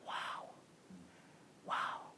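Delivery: breathless, whispered wow, very slow; awed whisper. Retold or imitated. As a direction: whisper it in stunned disbelief. Stunned by the promised visa.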